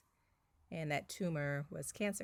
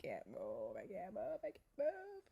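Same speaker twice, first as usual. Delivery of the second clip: soft tearful plea climbing higher each time; retold crying; 5 semitones higher, 6 dB quieter than usual. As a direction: whimper it, rising into panic. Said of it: "I was just, like, crying".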